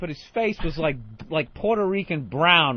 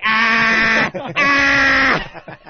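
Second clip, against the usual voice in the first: mocking clown cackle; 3 semitones higher, 7 dB louder than usual. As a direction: blast a maniacal laugh at each miss. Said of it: "every ball they throw, he's like".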